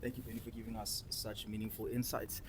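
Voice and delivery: normal talking voice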